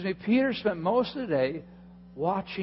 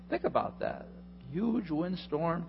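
ordinary delivery

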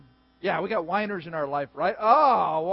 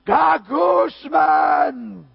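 very slow, loud, higher wailed gagusman; anguished shout; 6 semitones higher, 8 dB louder, much slower than usual. Pretend. Bears lost, fan wails a loud drawn-out groan. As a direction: wail gagusman like a sports fan in agony. Acted complaint about Bears losing.

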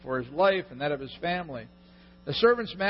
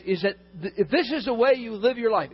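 ordinary delivery

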